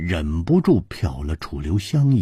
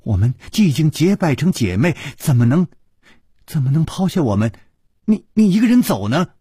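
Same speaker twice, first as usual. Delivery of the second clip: high, fast anxious pleading; urgent, anxious; 9 semitones higher, faster than usual. A narrator's reading. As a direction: protest in a rush, stumbling over words. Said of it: "宋田儿急着说". (着急地高声挽留)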